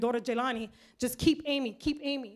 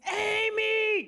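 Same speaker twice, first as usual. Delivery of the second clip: high, drawn-out yell; father's scream; 9 semitones higher, 4 dB louder than usual. Retold or imitated. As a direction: holler the name across a pool. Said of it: "just turns around and screams".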